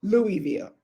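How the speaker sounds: Louisville said LOO-ee-vil, no s; Louisville with a clear ee and no s; alternate pronunciation. Shown as a demonstration. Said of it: "then say".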